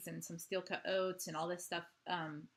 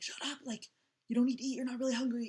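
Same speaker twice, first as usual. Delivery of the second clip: loud, higher irritated snap; snapping at kids; 6 semitones higher, 6 dB louder, faster than usual. Retold or imitated. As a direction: bark it at the hungry kids. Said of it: "I found myself snapping".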